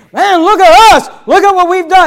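high, loud crowing in imitation; mock boasting. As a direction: show off like a proud crowd. Mimics a congregation boasting.

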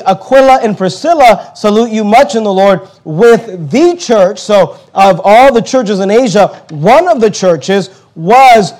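preaching at full force. Acted. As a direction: drive the point home to the congregation.

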